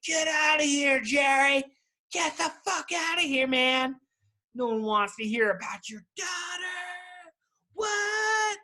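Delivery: high, loud fed-up heckler shouting the guy off stage; hammed-up heckler screaming at a comic with a guitar; mock heckling yell. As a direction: bellow him off the stage, way up high.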